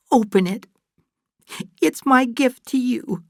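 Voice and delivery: high-pitched, emotional motherly voice; eager gift-giving. Acted. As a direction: urge the gift on a loved daughter, voice lifted high. Fay gives Kate her gift.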